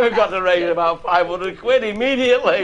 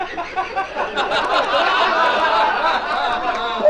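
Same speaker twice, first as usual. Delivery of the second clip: high laugh tailing down; high laugh falling away; hearty laugh; 11 semitones higher than usual. Real, not acted. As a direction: laugh at his own story.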